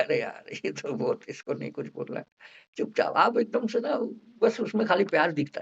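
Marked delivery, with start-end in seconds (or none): 0.0-2.3 doctor's inner thought. Retold or imitated. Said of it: "कि आप लगा".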